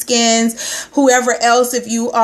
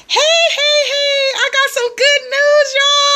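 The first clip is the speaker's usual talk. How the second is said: singsong greeting; 3 dB louder than usual. Real, not acted. Host greeting viewers joining her live stream, high singsong. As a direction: croon each name warmly to new viewers.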